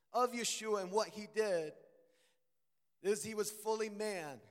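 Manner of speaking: usual speaking voice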